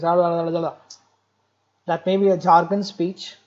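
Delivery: garbled babble, then the words; jargon speech demo. Shown as a demonstration. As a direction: babble it like a confused patient. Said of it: "a patient comes and talks like to me like this".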